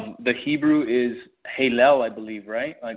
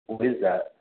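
normal talking voice

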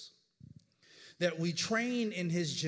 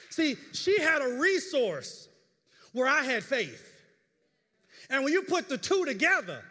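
preaching climax; 7 semitones higher, 6 dB louder than usual. Real, not acted. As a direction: build the point up loud and high.

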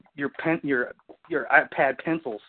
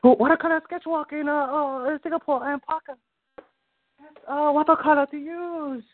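mocking Parker; 11 semitones higher than usual. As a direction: whine it high like an annoying bore. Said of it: "Fucking boring and annoying".

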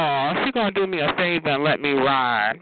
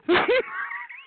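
sudden very high shriek of a laugh. Real, not acted. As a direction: let a mocking laugh fly up high. Mocking the caller on chatline.

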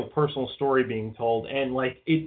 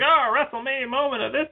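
much higher-pitched parody of hype; mock hype; 11 semitones higher than usual. Host mocking what they always say, mock hype. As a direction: gush it like a forced big moment.

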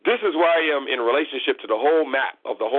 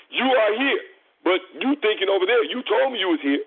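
pitched way up, fired-up scolding; heated rant; 8 semitones higher than usual. Real, not acted. Host scolding the water-sign caller, heated and high-pitched. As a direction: lecture him high and worked up, not shouting.

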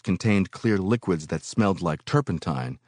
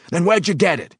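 angry demand; 12 semitones higher, 7 dB louder than usual. Acted. Foreman furiously demanding who gave him the liquid. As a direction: grill him hard. Boss interrogating.

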